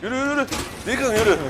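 high, rushed urging; urgent urging. Real, not acted. Gamer urging his character to run, urgent. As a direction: hurry him along.

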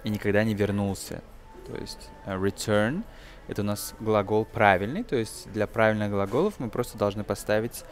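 ordinary talk, nothing marked

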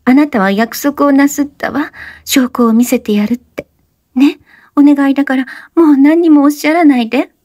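put-on high young woman's voice, urgent plea; breathless high-pitched plea; Oshino, pleading. A narrator's reading. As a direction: beg him to stop talking.

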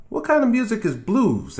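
put-on high, curious kid asking; curious child. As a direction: ask like a curious boy. Child voiced in story.

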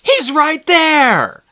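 put-on high, slow, loud girl's shout; a shocked girl crying out; high, loud, stunned shout; Annabeth shouting. A narrator's reading. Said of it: "Her face went blood red".

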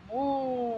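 slow, drawn-out mooing cow sound. Shown as a demonstration. Teacher showing kids the cow sound, slow moo. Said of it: "You can make a sound like cow".

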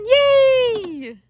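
normal talking voice

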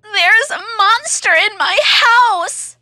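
high, loud, panicky little voice; frightened hare. Acted. Hare telling Monkey about the monster, scared and high. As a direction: blurt it out in a scared squeak. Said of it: "Hare says".

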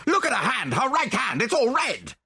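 urgent, high-pitched alarm; horrified cry. A narrator's reading. Narrator points out the widow's red hand to detective, crying out aghast. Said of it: "I cried".

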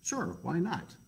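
lightly voiced bear agreeing; casual Bear.

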